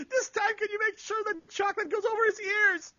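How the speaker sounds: high-pitched words through giggles; squeaky, tearful laughing talk; helpless laughter. Real, not acted. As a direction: crack up at your own joke. Joke told in laughing fit.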